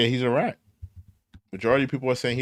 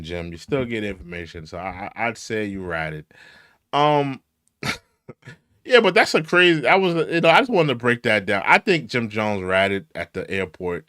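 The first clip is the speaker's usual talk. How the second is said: usual speaking voice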